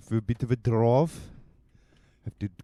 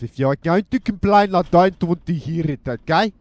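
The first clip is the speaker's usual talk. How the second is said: loud ranting burst at the passengers; outburst; 7 semitones higher, 9 dB louder than usual. Acted. Driver ranting at would-be complainers, loud outburst. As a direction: blow up at the complainers, then catch yourself. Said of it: "sorry about that outburst".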